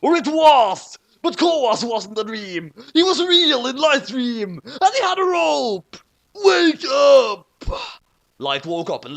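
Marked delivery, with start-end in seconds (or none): 0.0-6.0 higher, louder climactic narration; excited dramatic reveal; dramatic excitement. A narrator's reading. 6.3-7.5 loud high shout; loud high yell. A narrator's reading. Shout in dream.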